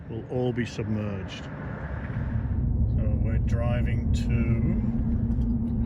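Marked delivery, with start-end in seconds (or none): 1.4-5.9 talking while bouncing down a rough road; on a bumpy road. Real, not acted. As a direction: voice jolted by a bumpy drive. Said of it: "sorry about the bumpy road".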